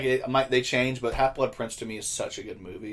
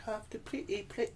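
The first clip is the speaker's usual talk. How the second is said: higher, softer mock posh British accent; posh British girl; 10 semitones higher, 10 dB quieter than usual. Showing the high-class British accent he likes, soft posh voice. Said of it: "a high class accent".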